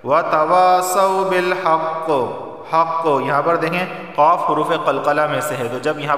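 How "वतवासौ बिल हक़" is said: slow chant ending in a bouncing qaf; qalqala on haqq. Shown as a demonstration. Teacher reciting before the rule, chanted qalqala on haqq. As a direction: recite slowly, echo the final qaf of haqq. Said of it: "आवाज़ मखरज से टकराएगी".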